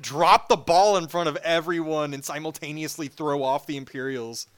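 ordinary delivery

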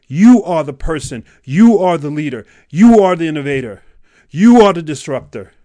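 building to loud, high-pitched declarations; rousing exhortation. Real, not acted. Coach telling listener they are the leader, rousing. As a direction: hammer each "you" like a rallying cry. Motivational climax urging the listener.

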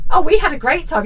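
high, loud sarcastic parroting; mocking smug child. Host mimicking the smarmy child in the book, mocking. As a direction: parrot the kid back, high and scornful.

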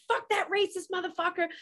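high, strained rant without real volume; retold flipping out. Tipsy neighbor ranting in the driveway, mock-furious. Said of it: "I was flipping out in my driveway".

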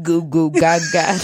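put-on slow goofy baby babble; baby talk. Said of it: "I'll show you childlike".

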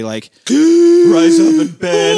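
sharp gasp, then slow and high; dramatic gasp. Retold or imitated. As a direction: gasp awake like in a movie. Dramatic dream scene retold.

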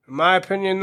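ordinary talk

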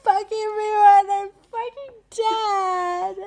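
high, loud, sobbing wail; mock breakdown wail. Exhausted person melting down over a blanket, loud high wail. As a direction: howl it like a meltdown over a blanket. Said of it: "You're like".

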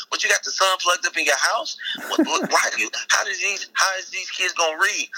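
high-pitched incredulous questioning; comic disbelief. Acted. Robber aghast at lady's light bill, incredulous. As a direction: stumble over the questions in mock outrage.

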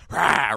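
playful feral snarl-back; imitated answering roar. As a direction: roar right back like a cat. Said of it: "and she goes".

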